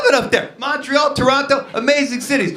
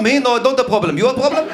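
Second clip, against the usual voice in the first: louder, defiant back-talk; Israeli retort; 6 semitones higher, 4 dB louder, much faster than usual. Retold or imitated. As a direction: throw the problem back. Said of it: "an Israeli guy".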